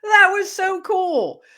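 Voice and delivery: high, slow gasp of wonder; a high, slow and childlike "oh my goodness"; awed exclamation. Retold or imitated. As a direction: marvel at it like a child. Acted awe exclamation.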